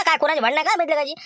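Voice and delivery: ordinary talk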